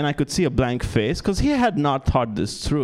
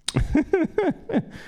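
high giggle. Real, not acted. Laughs at own joke.

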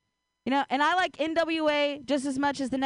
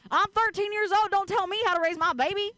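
put-on country accent; 6 semitones higher than usual. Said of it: "in a country accent".

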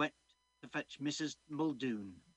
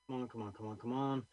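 normal talking voice

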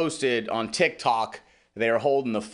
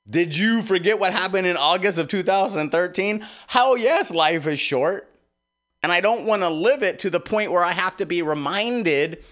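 heated retort; 7 semitones higher than usual. Retold or imitated. Retold quarrel with wife.

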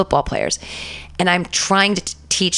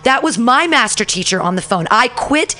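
much higher, emphatic re-enacted tirade; retold ranting outburst; 6 semitones higher than usual. Teacher quitting in front of her class, retold furious rant. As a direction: build to a raised, pointed accusation.

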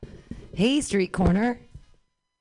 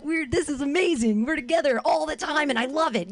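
higher, gushing mock delight; mock gushing couple; 7 semitones higher than usual. Comic imitating a lovestruck couple stuck at home, gushing. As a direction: gush like a smitten couple. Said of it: "And you're like".

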